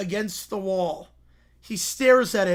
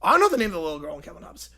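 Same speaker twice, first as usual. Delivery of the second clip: normal talking voice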